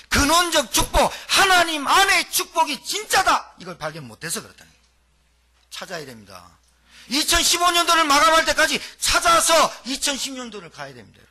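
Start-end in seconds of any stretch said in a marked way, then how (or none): 0.1-3.5 higher, louder and slow, preaching hard; preaching at full force. Acted. Preacher proclaiming identity to the congregation, full force. 7.1-10.7 preaching at full force. Acted. Preacher proclaiming identity to the congregation, full force.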